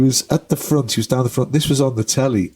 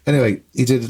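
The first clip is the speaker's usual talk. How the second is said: normal talking voice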